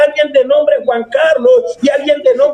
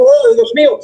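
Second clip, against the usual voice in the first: a high, mock cry of "Oh, Dios mío" in a pitched-up voice; retold cry of joy; 9 semitones higher than usual. Preacher voices a woman crying out her name, high and excited. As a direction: echo a woman's startled exclamation, half acted. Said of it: "Alguien grita como".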